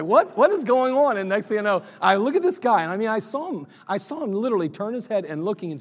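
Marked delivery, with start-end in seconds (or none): 0.0-1.2 startled. Retold or imitated. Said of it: "I was like".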